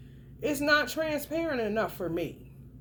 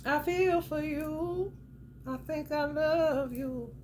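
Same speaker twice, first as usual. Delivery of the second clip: slow, higher-pitched, stretched words; drawn-out declaration; 4 semitones higher, slower than usual. Shown as a demonstration. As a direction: draw out each feeling word slowly. Mock-earnest demo of love words.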